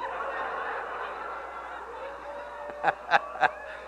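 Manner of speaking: a long wheezing silent laugh; real helpless laughter; breathy silent laugh. Real, not acted. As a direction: laugh at the punchline without voice. Laughs at own story.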